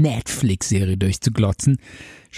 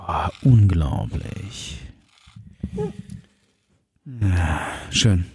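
low, slow, blissful murmur; blissed-out sigh; 8 semitones lower, much slower than usual. Pretend. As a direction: savour it like deep calm. Relaxing with Zen garden gong.

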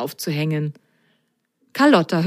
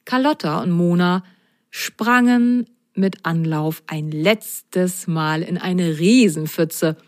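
ordinary delivery

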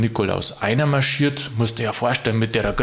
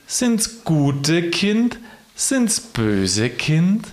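poem recitation; 4 semitones higher, much slower than usual. Acted. Christkind questioning Knecht Ruprecht about sack and rod, slow theatrical recital. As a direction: recite with long vowels and swooping tune.

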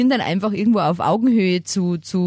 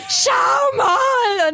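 put-on excited child. Mother imitating her son showing off his potty, high excited child shout. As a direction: shout it like a proud toddler.

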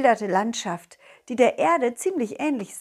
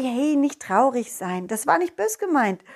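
ordinary delivery, nothing marked

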